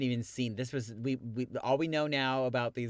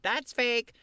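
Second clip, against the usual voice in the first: higher, louder imitation of complaining viewers; mock outcry, pitched up; mock objection; 13 semitones higher, 5 dB louder than usual. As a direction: call it out like a nitpicking fan. Imagined viewers objecting to the resize.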